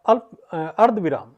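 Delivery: ordinary talk, nothing marked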